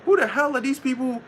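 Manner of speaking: mock-bewildered deity; incredulous God. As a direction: play God, puzzled at the people. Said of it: "The Lord looking around like".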